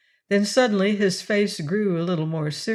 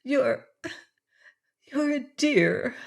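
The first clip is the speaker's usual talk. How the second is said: higher, muffled against a coat; 8 semitones higher, slower than usual. A narrator's reading. Estelle into Arthur's coat, higher and muffled. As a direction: speak into his shoulder, shy. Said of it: "Her voice was muffled by his coat".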